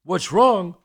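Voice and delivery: loud, high retold outrage; outraged echo. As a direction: throw the question back, appalled. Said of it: "He's freaking out".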